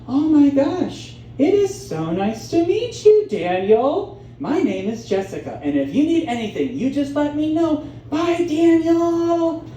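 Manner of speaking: sweet, high-swinging server voice; gushing waitress. Retold or imitated. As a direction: coo it, stretching the goodbye high. Acted gushing waitress greeting him.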